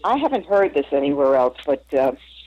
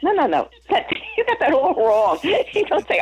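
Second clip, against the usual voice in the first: laughing protest; 9 semitones higher than usual. Retold or imitated. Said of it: "And I'm like".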